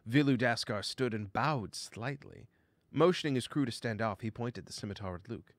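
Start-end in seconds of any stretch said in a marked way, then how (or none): none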